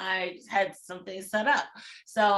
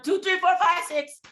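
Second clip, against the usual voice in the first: counting faster into alarm, higher and louder; rising panic; 8 semitones higher, 7 dB louder than usual. Retold or imitated. Spotting water bugs on a first date, panicked and climbing.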